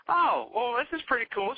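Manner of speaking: high-pitched, impressed mock feminine voice; imitated woman. Woman looks at their website, imitated high impressed voice. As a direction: go way up high as her. Voicing the woman seeing the site.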